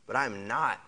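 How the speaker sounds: ordinary delivery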